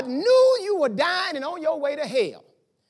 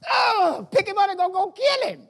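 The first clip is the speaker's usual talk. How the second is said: high, outraged yelps from the crowd; preacher voicing the crowd's outrage at Jesus, high and angry; 8 semitones higher, 3 dB louder than usual. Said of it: "And they go".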